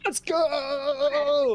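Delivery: loud, high victory cheer; excited cheer. Real, not acted. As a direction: shout it out in triumph. Door shattered, player cheers.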